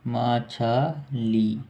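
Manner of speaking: stretched out syllable by syllable; मछली drawn out very slowly, each syllable held; slow dictation. Shown as a demonstration. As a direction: dictate it slowly for the class to write. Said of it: "fish की अर्थ है मछली".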